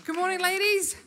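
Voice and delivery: high, loud Good morning, ladies; loud greeting. Real, not acted. Guest speaker greeting the ladies again, loudly. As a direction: sing out the greeting to the crowd.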